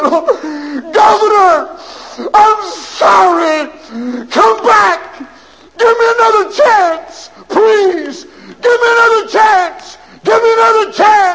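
high, loud wailing plea; desperate crying plea. Acted. Condemned man wailing to the governor for another chance. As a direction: beg through tears for mercy. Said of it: "shook the bars and cried".

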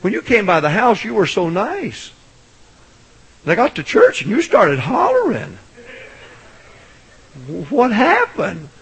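high, mock-weepy complaint; imitated tearful lady. Preacher retelling a bewildered church lady, mock tearful. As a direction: plead in a high, put-on tearful voice. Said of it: "She started crying".

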